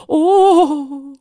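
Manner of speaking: high, loud, long "oh"; relieved moan. A narrator's reading. Rector feeling the drops work, moaning in relief. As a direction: let out a long blissful "oh".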